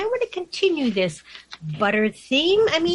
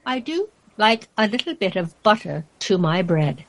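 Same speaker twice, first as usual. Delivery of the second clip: measured, lilting storybook verse; poem recitation; 3 semitones lower than usual. Acted. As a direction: read the rhyme theatrically, giving each character a voice. Reads a poem aloud.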